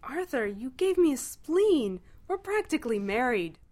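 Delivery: high, loud, drawn-out exclamation; gushing insistence. A narrator's reading. Ford tells Arthur they're practically married, gushing. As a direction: declare it with overjoyed certainty.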